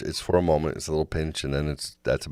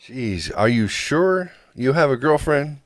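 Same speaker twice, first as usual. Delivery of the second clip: higher, slow teasing jab; mock her incredulous teasing; playful, drawn-out incredulity; 9 semitones higher, slower than usual. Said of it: "retold quote".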